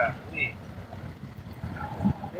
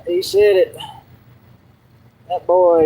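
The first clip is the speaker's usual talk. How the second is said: normal talking voice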